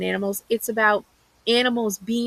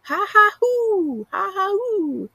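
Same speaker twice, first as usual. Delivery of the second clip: slow, high ha ha hoo cackle; stretched-out mocking cackle; mocking animal laugh; 12 semitones higher, slower than usual. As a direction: draw the jeering laugh out high. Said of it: "makes me laugh harder".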